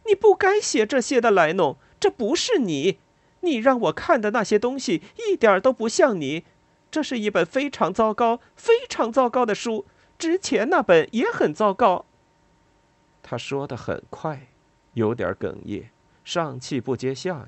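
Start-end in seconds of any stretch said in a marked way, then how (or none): 0.1-12.1 much higher, louder near-shout; almost shouting. A narrator's reading. Lila suddenly shouts that the narrator's book is bad. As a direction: burst out at her, almost yelling. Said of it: "几乎是叫喊着说".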